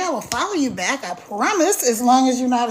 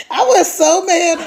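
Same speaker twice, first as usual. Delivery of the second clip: laughing; 5 semitones higher, 5 dB louder than usual. Real, not acted. Laughing about unflattering camera angle.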